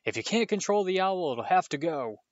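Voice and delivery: loud, angry demand; angry uncle. A narrator's reading. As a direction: lay down the law to the boy. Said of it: "he roared across the table".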